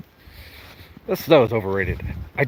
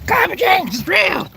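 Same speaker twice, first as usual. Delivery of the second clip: much higher, hammed-up proclamation; mock shout. Joking about Texas snow to the camera, mock shout. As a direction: yell it like a fake protest.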